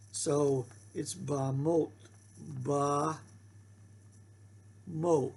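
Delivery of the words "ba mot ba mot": ba mot drawn out slowly, syllable by syllable; teacher slowly sounding out ba mot; slow syllable reading. Shown as a demonstration. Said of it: "Syllable begins with a consonant".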